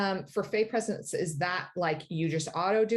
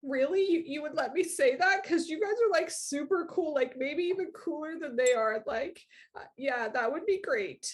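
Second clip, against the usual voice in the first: put-on awed kid; 7 semitones higher than usual. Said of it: "he's like".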